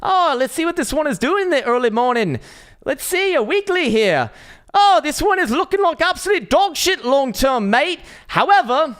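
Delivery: loud, high, drawn-out mock Irish accent. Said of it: "in an Irish accent".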